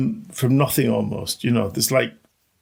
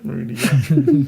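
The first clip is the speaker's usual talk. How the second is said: laughing; 2 semitones higher than usual. Real, not acted. Laughs at own corny remark.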